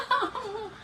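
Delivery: high, drawn-out "no" with a laugh; mock wail. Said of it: "I'm like".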